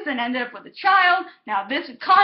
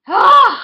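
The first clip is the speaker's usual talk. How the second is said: loud, high wail of frustration; exasperated cry; 10 semitones higher, 10 dB louder than usual. Real, not acted. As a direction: cry out the oh, fed up. Venting about the ship.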